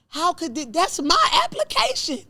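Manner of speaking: high, loud indignant wail, no real sobs; retold tearful protest. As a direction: re-enact an upset, disbelieving outburst. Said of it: "I cried like a baby".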